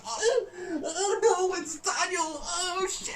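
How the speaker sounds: very high, laughing, half-crying shriek; shocked squeal. Retold or imitated. Fan retelling the fight scene, shrieking with laughter. Reacting to Daniel stepping in.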